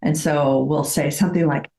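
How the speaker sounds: normal talking voice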